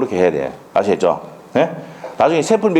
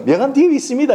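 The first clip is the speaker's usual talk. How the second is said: mock cheerful answer, pitched much higher on "뒤에"; agent's breezy reply; 12 semitones higher, faster than usual. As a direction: play the agent tossing off "명함 뒤에 있습니다" lightly, high and sing-song. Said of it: "이러죠".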